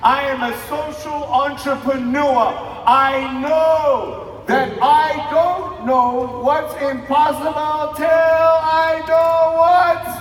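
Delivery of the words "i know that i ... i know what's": drawn-out, loud, half-sung finish; sing-song rallying climax; rousing shout. Real, not acted. Rousing finale to crowd.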